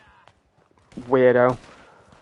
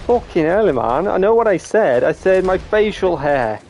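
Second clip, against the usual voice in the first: higher, insistent self-defence; exasperated protest; 5 semitones higher than usual. Real, not acted. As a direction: protest that you were misheard. Exasperated at being misunderstood.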